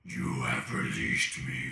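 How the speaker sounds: slow, deep booming genie. Acted. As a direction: rumble it like a freed genie. Genie emerges from box.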